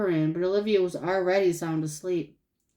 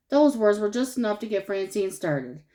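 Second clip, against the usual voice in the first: ordinary delivery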